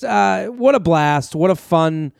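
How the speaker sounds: normal talking voice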